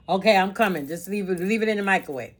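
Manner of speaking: loud, high call to another room. Real, not acted. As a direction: shout it over to someone in the kitchen. Calling out to family member.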